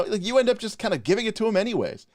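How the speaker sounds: ordinary talk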